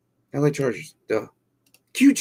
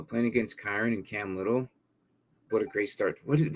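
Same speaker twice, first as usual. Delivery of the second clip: usual speaking voice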